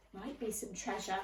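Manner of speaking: plain reading voice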